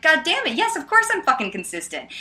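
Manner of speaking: high, mock-indignant retort; playful exasperation. Friendly debater answers the surprised man, playful exasperation. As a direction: protest playfully, pitched well up. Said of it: "I'm really playful about it".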